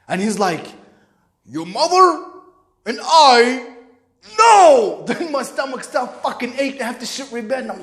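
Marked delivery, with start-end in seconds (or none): 1.5-5.0 high, loud, halting outburst; stern, angry father. Retold or imitated. Father forbidding his son, loud acted indignation. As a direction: build up, then explode on the last word. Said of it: "I know the tone in his voice".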